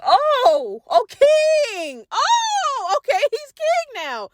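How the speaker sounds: sharp high outraged shout; outraged disbelief. Retold or imitated. Betrayed Maleficent hearing he's king, high outraged cry. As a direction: shriek it up high in furious disbelief.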